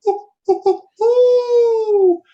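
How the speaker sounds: high, loud hooting owl calls; high, loud imitated owl hoots. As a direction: hoot like an owl out in the dark woods. Imitating owl call heard.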